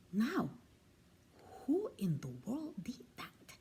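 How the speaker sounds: a night watchman wondering in the dark; hushed watchman. A narrator's reading. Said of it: "he exclaimed".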